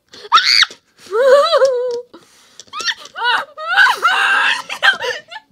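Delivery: loud high shrieking laugh. Real, not acted. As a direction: scream with laughter. Laughs while game chaos.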